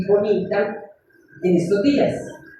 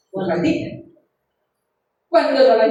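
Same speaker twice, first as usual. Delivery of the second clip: usual speaking voice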